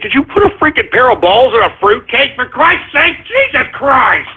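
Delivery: rising, high-pitched rant; exasperated outrage. Real, not acted. Host scolding a listener over a silly name, mounting outrage. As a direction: build to a high, exasperated cry.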